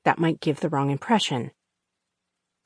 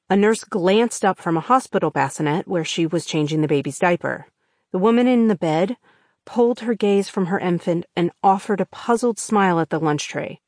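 ordinary delivery

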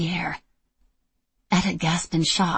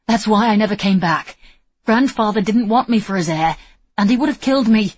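panicked; 4 semitones higher, 7 dB louder than usual. Acted. Julian panicked confession.

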